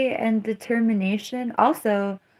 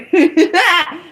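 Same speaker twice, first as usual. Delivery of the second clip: laughing; 10 semitones higher, 7 dB louder than usual. Real, not acted. Laugh after punchline.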